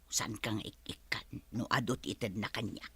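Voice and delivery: whispered secret question; hushed conspiratorial aside. Acted. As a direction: drop to a whisper. Secret aside about money.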